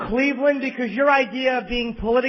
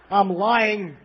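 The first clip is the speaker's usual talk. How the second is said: ordinary talk, nothing marked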